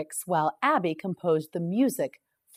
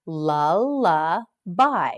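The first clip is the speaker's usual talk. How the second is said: "Lullaby" drawn out at half speed, each syllable clear; teacher slowing "Lullaby" right down; slow model word; 4 dB louder, much slower than usual. Shown as a demonstration. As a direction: stretch "Lullaby" slowly for the class.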